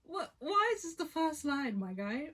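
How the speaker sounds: shocked outburst. Real, not acted. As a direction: blurt it out in shock. Shocked by the first line.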